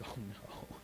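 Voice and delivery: whispered gasp of dismay. Retold or imitated. Preacher voicing brothers finding the cup, a whispered gasp. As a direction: breathe it out in horror.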